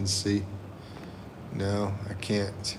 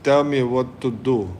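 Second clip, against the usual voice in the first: a mock Indian accent, loud and high; 4 semitones higher, 8 dB louder than usual. Imitating the Indian chatter in an Indian accent. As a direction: put on an Indian accent, brash. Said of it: "In the Indian accent".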